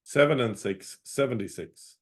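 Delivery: calling each number out, then repeating it; sing-song bingo number calls; bingo caller. Acted.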